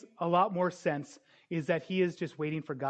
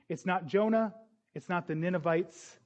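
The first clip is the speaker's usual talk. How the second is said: ordinary talk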